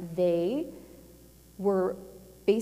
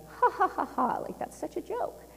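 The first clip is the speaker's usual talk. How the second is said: mock laugh, high and scornful; mocking dismissal; 10 semitones higher than usual. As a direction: scoff at it like a silly joke. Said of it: "they would downplay it like".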